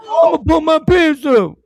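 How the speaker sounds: a hammed-up teen sizing up; mocking the teen. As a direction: play the boy puffing up to fight.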